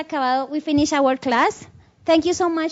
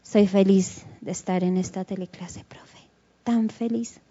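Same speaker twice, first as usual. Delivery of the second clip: susurro grave y apagado, sin ninguna alegría; felicidad sin entonación; 7 semitones lower, 3 dB quieter than usual. Shown as a demonstration. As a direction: di que estás feliz como si no lo estuvieras, casi susurrando. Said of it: "estoy dando una idea errada del lenguaje". (felicidad nada convincente, en susurro bajo)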